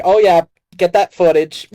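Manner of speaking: lightly voiced smug billionaire; smug villain. Lex telling his girlfriend to film Superman, lightly smug. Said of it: "He's like, he's like".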